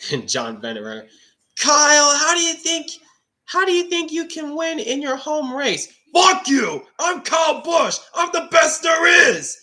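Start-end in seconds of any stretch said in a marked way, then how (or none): 1.6-5.9 high, squeaky mock interviewer voice. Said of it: "that was a Michael Rooks impression". 6.1-9.6 high, loud shouted parody; Kyle Busch impression. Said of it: "Michael Rooks impression when he used to do his Kyle".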